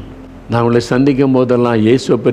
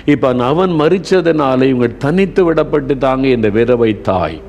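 normal talking voice

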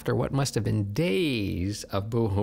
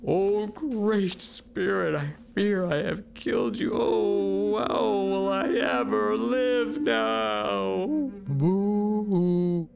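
hammed-up despair; 7 semitones higher, slower than usual. Eagle's mock lament to Spirit.

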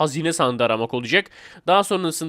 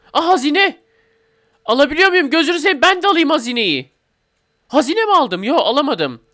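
excited; 8 semitones higher, 4 dB louder than usual. Real, not acted. Spots treasure, tries to grab it.

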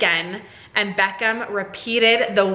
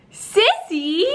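high, rising pet-name call; playful calling out; 7 semitones higher than usual. Real, not acted. Mom calling her little girl, high and playful.

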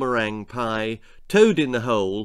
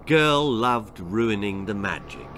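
usual speaking voice

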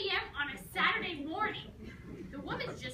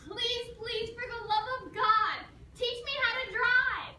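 higher, much louder pleading; begging; 8 semitones higher, 8 dB louder than usual. Retold or imitated. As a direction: beg dad desperately. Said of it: "I'd beg my dad".

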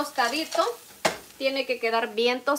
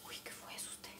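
a sudden frightened whisper; startled whisper; 26 dB quieter, faster than usual. Real, not acted. Startled by the falling bowl, a frightened whisper. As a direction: gasp it out, caught off guard by a noise. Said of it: "me sacó el susto".

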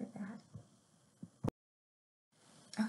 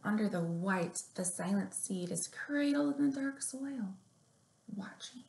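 gentle picture-book read-aloud; story reading; 2 semitones lower, slower than usual. Acted. Librarian reading a picture book to little kids, gently.

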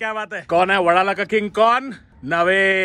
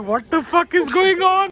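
confused bystander; 12 semitones higher, 5 dB louder, slower than usual. Retold or imitated. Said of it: "he must be like".

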